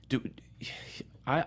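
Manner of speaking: normal talking voice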